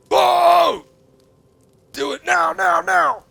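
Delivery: loud, very high rallying shout. Real, not acted. Streamer urging viewers to vote, shouting loudly. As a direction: yell at the audience to go vote.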